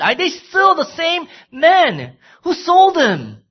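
full-force pulpit delivery; fervent preaching. Acted. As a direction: preach it, building the heat.

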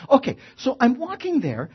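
ordinary delivery

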